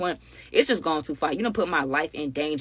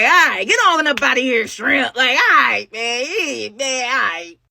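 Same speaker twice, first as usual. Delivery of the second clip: loud, higher-pitched scornful brush-off; mock dismissal; 6 semitones higher, 6 dB louder, slower than usual. Woman sending off the man who threatened them, mock dismissal. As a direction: wave him off like small fry.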